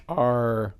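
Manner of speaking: usual speaking voice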